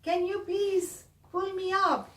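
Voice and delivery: high, loud call upward; Sarah calling up from the backyard, high and loud. Acted. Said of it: "and said".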